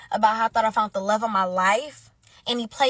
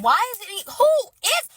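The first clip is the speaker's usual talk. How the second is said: very high, loud broken-off questions; indignant, high-pitched stammer; sputtering outrage; 8 semitones higher, 5 dB louder than usual. Acted. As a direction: squawk it and cut off.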